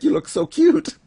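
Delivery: high-pitched mock squeal; hammed-up adoring squeak; gushing admiration. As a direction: coo it way up high, rising at the end.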